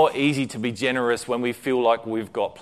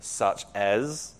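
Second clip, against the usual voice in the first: doubtful query; 3 semitones lower, 7 dB quieter than usual. A narrator's reading. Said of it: "she inquired".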